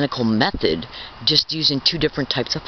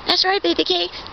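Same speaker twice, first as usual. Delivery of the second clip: mocking, much higher sing-song; high taunting voice. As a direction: jump way up and taunt them. Taunting the gang stalkers again.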